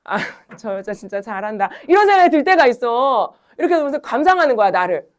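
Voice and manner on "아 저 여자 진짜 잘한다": amused student. Retold or imitated. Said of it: "무슨 생각이 드냐면".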